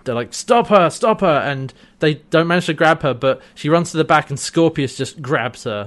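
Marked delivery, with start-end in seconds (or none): 0.3-1.3 higher, louder mock alarm; urgent calling out. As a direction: cry out for someone to grab a runaway. Traders calling after fleeing girl.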